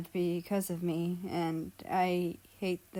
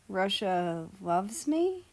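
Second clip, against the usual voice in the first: ordinary delivery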